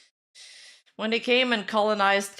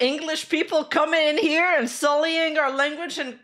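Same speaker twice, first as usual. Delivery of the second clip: loud, high-pitched outraged Quebecer act; mock indignation; 7 semitones higher, 5 dB louder than usual. Mimics Quebecers outraged at English speakers.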